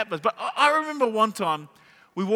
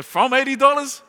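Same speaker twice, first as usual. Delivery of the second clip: louder, higher outraged double-take; indignant disbelief; 5 semitones higher, 5 dB louder than usual. Retold or imitated. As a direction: echo the price back in mock outrage. Retelling shock at the price tag.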